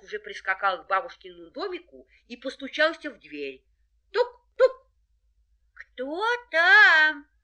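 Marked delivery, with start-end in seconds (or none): 4.1-4.9 knocking sound. A narrator's reading. Wolf knocks on door. 5.7-7.3 put-on high, loud old granny; grandma voice. A narrator's reading. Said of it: "спросила бабушка".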